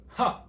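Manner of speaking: loud, high single "ha"; sudden sharp fake laugh; mock laugh. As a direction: burst out with a put-on scoffing laugh. Acts out reaction to silly topics.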